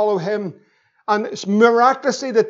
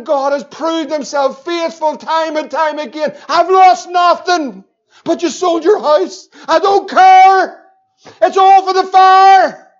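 loud, high preacher's shout; preaching at full force; 8 semitones higher, 7 dB louder, slower than usual. Acted. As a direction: lift it to a roaring climax for the crowd.

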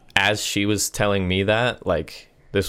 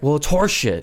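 imitated yelling; 8 semitones higher than usual. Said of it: "he just starts fucking yelling".

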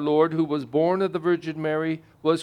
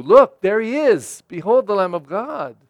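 eager pointing out; 3 semitones higher, 7 dB louder than usual. Retold or imitated. Pointing out Jesus to others.

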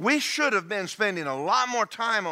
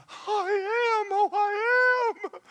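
whimpering sinner; 4 dB louder, much slower than usual. Retold or imitated. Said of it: "And you".

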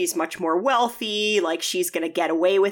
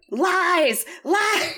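high-pitched, playful cry of accusation; comic outraged wail breaking into laughter; mock accusation; 12 semitones higher, 3 dB louder than usual. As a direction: heckle a liar, voice soaring. Viewer heckling a character's excuse.

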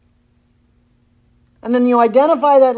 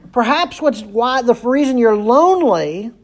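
ordinary talk, nothing marked

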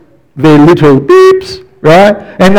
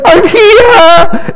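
put-on very high, bright kid's call; cheeky child; 3 dB louder than usual. Kid popping out from the shirt rack, high cheeky call. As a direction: pop out like a cheeky hiding child. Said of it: "and then they go".

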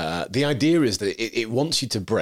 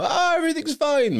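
very high, airy reassurance; sing-song it like someone without a care; breezy mock cheer. Podcaster imitating people untouched by misfortune, breezy mock cheer.

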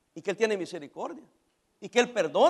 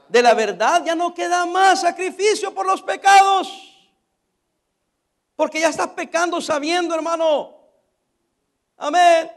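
loud, forceful scripture declamation; full-force preaching; 3 semitones higher, 7 dB louder than usual. Acted. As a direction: thunder the warning at the congregation. (predicación a toda fuerza)

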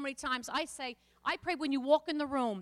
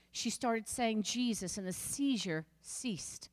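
ordinary delivery

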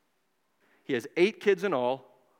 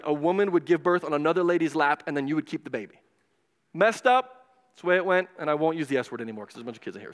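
normal talking voice

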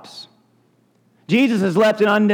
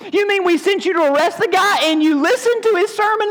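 much higher, outraged question; indignant; 11 semitones higher than usual. Acted. Indignant leaders scolding the officers, high and outraged. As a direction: scold them for failing the job. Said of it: "the rest of them were indignant".

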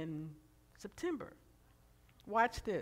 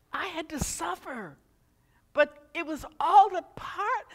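loud, higher, rising preaching voice close to tears; impassioned preaching; 11 semitones higher, 18 dB louder than usual. Acted. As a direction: preach it with tears behind the words. Emotional preaching climax.

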